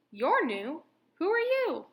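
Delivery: frog voice. A narrator's reading. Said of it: "said a frog".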